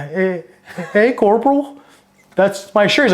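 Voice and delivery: high, tentative mock protest; hesitant objection. Boot confronting a corporal over stolen shirt, hesitant. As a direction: act out a junior nervously addressing a superior.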